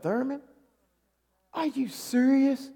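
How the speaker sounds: ordinary talk, nothing marked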